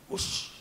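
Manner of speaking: soft, airy swish of a missed swing; quiet voiceless swoosh; imitated whoosh. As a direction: imitate a racket cutting through empty air.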